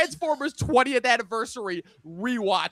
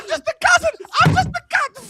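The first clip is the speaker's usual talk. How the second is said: high mock voice; 6 dB louder than usual. Quoting a movie line.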